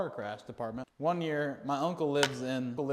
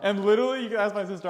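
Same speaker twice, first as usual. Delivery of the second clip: normal talking voice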